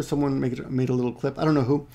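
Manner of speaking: ordinary talk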